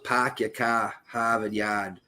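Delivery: slow Boston demo, "park your car" with no R; Boston accent. Shown as a demonstration. Explaining the Boston accent, slow deliberate Boston demo. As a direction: drop every R: "pahk yah cah, Hahvahd Yahd". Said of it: "So there's no really R".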